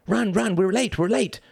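high, urgent hurrying cry; frantic cry. A narrator's reading. Badger driving the runners on, high frantic cry. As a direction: chivvy them along in high alarm. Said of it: "cried Mister Badger".

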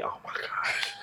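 whispered, exasperated aside; dismayed whisper. Retold or imitated. To a drunk friend wanting late food, dismayed whisper. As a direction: whisper it under your breath in mock dismay.

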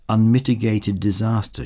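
plain reading voice

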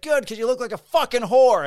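high, very loud angry retort; retold yelled comeback. Officer answering the woman's camera threat, retold angry yell. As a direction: bellow the insult back at her, imitating him. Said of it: "Frank's yelling at her".

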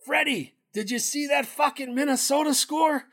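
put-on high, pushed-up old Italian guy on speakerphone. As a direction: ham up the fed-up old caller, way up in pitch. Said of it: "He goes".

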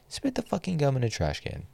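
fed-up demand, voice jumping up; exasperated repeat. Real, not acted. Ranting, repeats the command.

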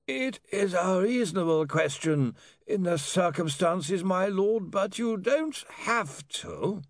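high, slow lawyer's voice; lawyer Slant, patiently advising. Acted. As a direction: stretch each phrase out in a high, measured voice. Slant's held character voice.

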